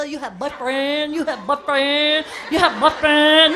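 broken-English nail tech, repeating. Retold or imitated. Mocking the nail tech asking about a boyfriend, accented. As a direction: chant it like a nosy manicurist. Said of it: "They always ask you the same question".

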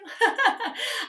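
short high laugh. Real, not acted. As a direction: laugh at your own joke. Laughing at own dare.

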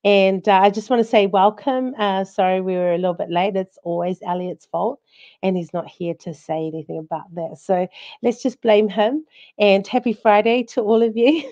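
normal talking voice

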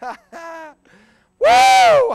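high, loud exultant "woo"; preacher's whoop. Real, not acted. Preacher overcome by the thief's conversion, whooping. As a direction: whoop from the pulpit.

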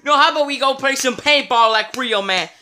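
usual speaking voice

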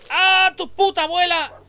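real jump-scare yell; shrieking curse; startled scream. Real, not acted. Scared by dog attack.

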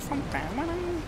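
tiny high caricature Brummie voice; Birmingham accent. Shown as a demonstration. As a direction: squeak it quietly in a Birmingham accent. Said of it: "I swear it's like that".

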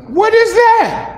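high, slow startled question; high, drawn-out "what is that". Acted. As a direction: jump at a voice inside you. Startled at inner voice.

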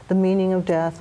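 ordinary talk

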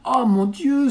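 high, loud cry of alarm; frightened cry. A narrator's reading. Sophie hears the tortoise may die, crying out in fright. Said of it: "s'écria Sophie".

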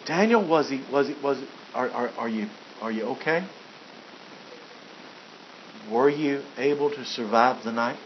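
a nervous king hardly daring to ask; the king stammers hushed questions into the den; timid worried king. Acted. King timidly asks Daniel.

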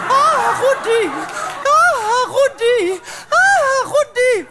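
high, drawn-out cries of his name; acted ecstatic moaning. Carried away with Rudy, acted ecstatic cries. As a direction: cry out in mounting pleasure.

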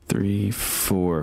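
ordinary talk

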